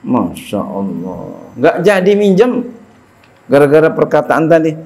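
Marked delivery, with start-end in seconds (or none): 0.1-1.2 low soft Masya Allah, pitched well down; a sigh of amazement, sunk low and soft; hushed awe. Acted. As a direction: breathe it out in wonder. Awe after the story.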